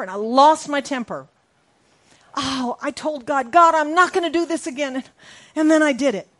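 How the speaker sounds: loud, high-pitched preaching; fiery preacher voice; preaching at full force. Acted. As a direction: preach it out big to the congregation.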